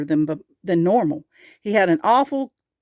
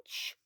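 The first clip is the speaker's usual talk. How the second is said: long rising howl of pain; drawn-out acted yowl; 22 dB quieter than usual. A narrator's reading. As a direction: howl "youch" from a sore tooth.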